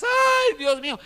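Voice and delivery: high-pitched imitation wail; mock lament. Preacher imitates people sunk in grief and tears, mock wailing. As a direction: cry out in a put-on lament. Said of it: "el lloro y las lágrimas".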